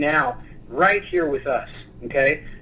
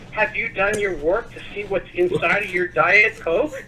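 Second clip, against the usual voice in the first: ordinary delivery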